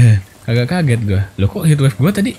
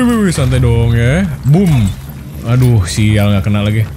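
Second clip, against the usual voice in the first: ordinary talk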